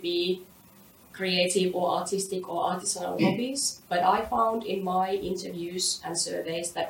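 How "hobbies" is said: ordinary talk, nothing marked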